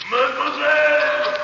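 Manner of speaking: put-on high, long-drawn French-accented call. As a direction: call loudly after someone out of sight. Madman calls after fleeing captive.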